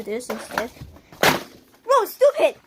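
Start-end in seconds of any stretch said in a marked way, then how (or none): 1.9-2.6 loud high scolding yell. Real, not acted. Yelling at kid breaking things.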